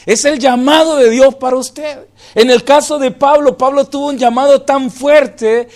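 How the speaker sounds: loud impassioned sermon voice; full-force pulpit preaching; fervent preaching. Acted. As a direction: preach it, pushing to the congregation.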